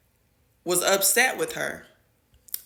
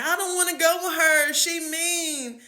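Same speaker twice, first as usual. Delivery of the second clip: higher, child-like plea, lightly voiced; imitating crying child; 10 semitones higher than usual. Crying student begging not to go, lightly voiced high whine. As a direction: whine it like a scared little girl. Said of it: "And she was saying".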